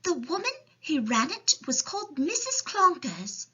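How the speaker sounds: put-on high little girl voice; child's storybook voice; Sophie. A narrator's reading. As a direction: explain as a young orphan, high-pitched. Sophie tells of orphanage.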